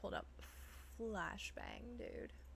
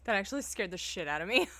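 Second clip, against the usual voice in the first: laughing; 8 dB louder, faster than usual. Real, not acted. Startled, laughing it off.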